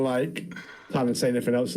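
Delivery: usual speaking voice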